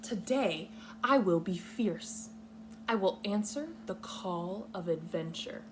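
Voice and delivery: normal talking voice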